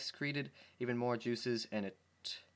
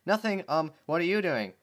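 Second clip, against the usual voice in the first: flustered; 6 semitones higher, 7 dB louder than usual. Acted. Dash caught, flustered reply.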